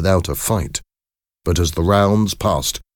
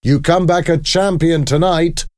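high-pitched rallying call, moderately raised; shouted encouragement; 10 semitones higher than usual. A narrator's reading. Trainer sends his boxer into the fight, shouted encouragement. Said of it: "he shouted over the noisy crowd".

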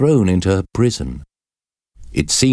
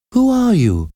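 high, frightened question from a prisoner; trembling voice. A narrator's reading. Prisoner questions the monk before her, trembling. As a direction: ask it very high, with a touch of trembling. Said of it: "asked in a trembling voice".

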